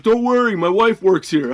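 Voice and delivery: high, loud mock customer voice; imitating a dismissive customer. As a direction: mimic him brushing off the complaint. Retold brush-off during a quarrel.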